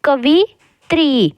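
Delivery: कवित्री slowed, with the final त्री held long; धीरे-धीरे कवित्री, लंबी ई पर ज़ोर; slow sounding out. Shown as a demonstration. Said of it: "ज़्यादा देर तक आवाज़ आ रही है तो बड़ी ई".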